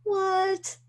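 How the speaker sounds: high, sharp yelp of surprise; startled disbelief. Retold or imitated. Seeing the YouTube video title, startled and incredulous. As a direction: caught off guard by the screen. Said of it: "And I went".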